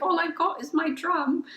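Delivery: put-on high, small innocent child voice; little drummer boy. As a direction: plead sweetly like a shy little child. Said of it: "little drummer boy energy".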